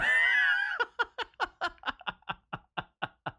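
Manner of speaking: real burst of laughter, high and hooting; shrieking laugh that falls away. Real, not acted. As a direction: crack up at your own joke. Cracks up at own joke.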